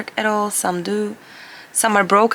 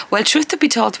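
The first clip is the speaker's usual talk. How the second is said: usual speaking voice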